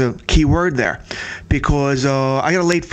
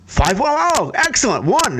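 pleased surprise; 8 semitones higher, slower than usual. Retold or imitated. Caller reliving the moment he saw the score, excited. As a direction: pitch jumps way up, delighted at the score.

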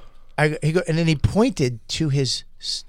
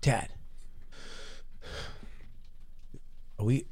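softly imitating a hurt son, much softer; quiet hurt kid; 4 semitones lower, 15 dB quieter than usual. Dad retells son's hurt whisper, quiet and sad. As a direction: hush it like a sad little boy. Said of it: "He goes".

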